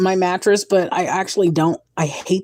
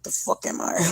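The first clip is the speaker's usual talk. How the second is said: voiceless mock baby retort; mock baby attitude; voicing baby; 7 dB quieter than usual. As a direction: whisper it as the unbothered baby. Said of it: "And he'd be like".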